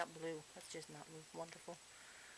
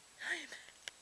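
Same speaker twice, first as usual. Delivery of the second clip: soft gasp; 7 semitones higher, 9 dB quieter than usual. Pretend. Sudden mock gasp.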